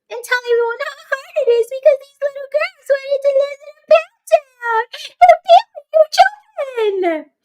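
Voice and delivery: very high hammed-up sobbing whine; squeaky, mocking cry-talk. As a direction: wail it like a fake tearful confession. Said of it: "crying in your car".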